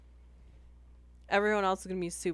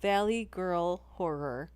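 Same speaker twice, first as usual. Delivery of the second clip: ordinary talk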